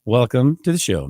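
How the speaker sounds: ordinary talk, nothing marked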